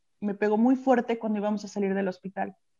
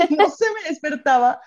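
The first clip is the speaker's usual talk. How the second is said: higher, laughing through the words; 6 semitones higher, 4 dB louder than usual. Real, not acted. Telling of throwing pillows at a sleeping husband, laughing. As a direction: tell it through breaking laughter.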